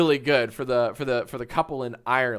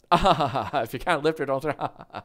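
imitating a laugh-along, slower; nervous laughter. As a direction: repeat it slowly through a put-on nervous chuckle. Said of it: "what does the Irish guy do?".

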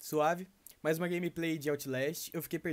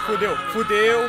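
being chased; 6 semitones higher, 11 dB louder, slower than usual. Real, not acted. Chased by monster in game.

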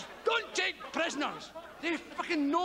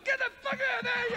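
yelling; 10 semitones higher, faster than usual. Retold or imitated. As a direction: shout the spider out of the trousers. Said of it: "I was going".

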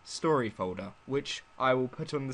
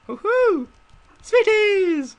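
high, loud excited cheer; excited whoop; 10 dB louder, much slower than usual. Real, not acted. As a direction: whoop with delight. Excited at the nice room.

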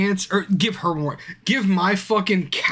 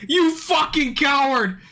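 higher, loud and drawn out, slow; angry yell; 10 semitones higher, slower than usual. Real, not acted. Streamer yelling again at his character, slow angry yell. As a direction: drag the insult out at the screen. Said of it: "Am I too loud when I yell like that?".

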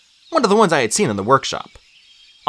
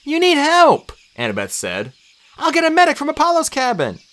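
put-on high, urgent girl's voice; much higher, insistent voice; worried girl. A narrator's reading. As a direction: press a hurt friend to accept help. Annabeth urges the injured Chiron.

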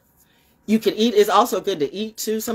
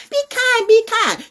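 mocking plea; 5 dB louder than usual.

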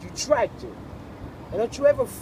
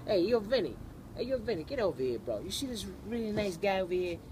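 put-on Brooklyn accent; 8 dB quieter than usual. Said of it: "you wanna hear a Brooklyn accent?".

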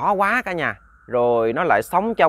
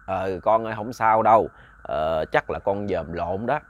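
put-on calm the child like an old grandpa; soothing grandfather; 6 semitones lower, slower than usual. A narrator's reading. Grandfather reassuring his grandchild he saw wrong, low and soothing.

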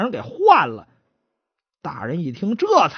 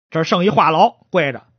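ordinary delivery, nothing marked

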